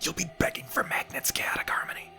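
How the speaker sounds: soft, mock-ominous whisper; hushed stage whisper; teasing whisper. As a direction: whisper the warning right at them. Teasing warning whispered to viewer.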